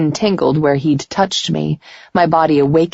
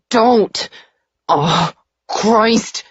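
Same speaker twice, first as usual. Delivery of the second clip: strained, pitched-up cry of distress; agonised plea; 6 semitones higher, much slower than usual. A narrator's reading. Man writhing on the bed in nightmare, agonised. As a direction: cry out in torment.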